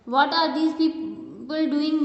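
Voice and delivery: word by word, very slow and clear; slow classroom read-aloud; slow dictation. Shown as a demonstration. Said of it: "teacher reads sentence aloud".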